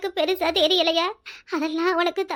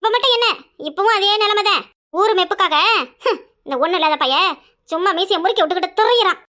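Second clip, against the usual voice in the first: scornful tirade; 6 dB louder than usual. Acted. Mother rants about her son.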